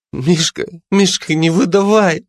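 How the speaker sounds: put-on high, begging drunk plea; pleading drunk. A narrator's reading. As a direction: beg him not to give you away. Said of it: "взмолился он". (пьяная мольба)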